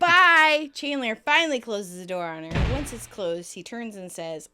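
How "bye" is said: loud, higher farewell; mock called goodbye. Friend shooing Janice out the door, loud mock goodbye. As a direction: call it out big and final.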